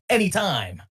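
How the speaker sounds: smooth, slowed radio-host sign-off. Acted. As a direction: croon it like a late-night DJ. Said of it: "you sounded like a radio host".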